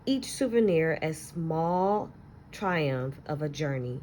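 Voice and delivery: put-on expressive read-aloud to children, lingering on key words; story narration. Teacher reading a picture book to her class, expressive storytelling.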